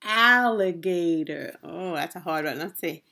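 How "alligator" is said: "Alligator" slow and loud in four beats; syllable by syllable. Shown as a demonstration. Teacher naming a picture for children, syllable by syllable. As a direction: stretch out each syllable of "Alligator".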